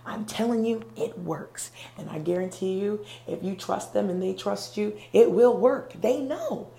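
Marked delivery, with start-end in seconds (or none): none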